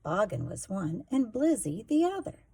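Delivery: ordinary delivery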